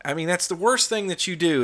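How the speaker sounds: ordinary talk, nothing marked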